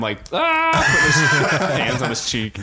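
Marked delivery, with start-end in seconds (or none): 0.3-1.5 high open-mouthed comic "ah"; mock scream. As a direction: do a cartoonish startled scream. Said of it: "quick cutaway to him".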